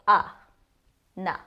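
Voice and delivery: "a, na" with the vowel of up; clipped "a" then "na" like up; short a as in up. Shown as a demonstration. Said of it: "the same sound you get when you say the word up".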